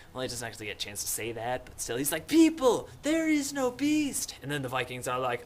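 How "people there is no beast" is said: high, loud, stretched announcement; urgent mock cry. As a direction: call out desperately to the crowd. Simon repeats his cry, acted.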